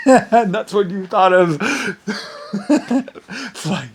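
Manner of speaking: slow, high, laughing. Real, not acted. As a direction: talk through giggles. Still laughing at story.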